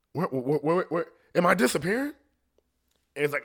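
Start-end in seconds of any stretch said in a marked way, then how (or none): 0.1-2.1 stammered, rising alarmed question; mock bewilderment, quick and climbing higher; imitating someone startled to be vanishing; confused panic. Acts out her confusion on vanishing.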